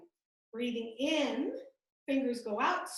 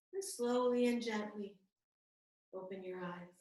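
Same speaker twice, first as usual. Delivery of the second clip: guided meditation. Real, not acted. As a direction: settle them into stillness, unhurried. Guides class into stillness.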